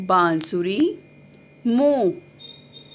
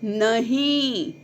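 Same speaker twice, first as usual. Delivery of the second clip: very slow, stretched नहीं for the class to repeat; teacher leading the class in a slow chorus; drawn-out model word; 2 semitones higher, much slower than usual. Shown as a demonstration. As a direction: draw out नहीं so children can copy it. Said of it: "बोलिए सभी बच्चे".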